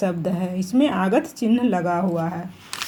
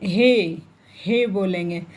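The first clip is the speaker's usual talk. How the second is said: normal talking voice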